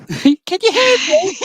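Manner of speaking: high, slow, through a laugh. Real, not acted. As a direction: giggle through the question. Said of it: "Can you hear me now?".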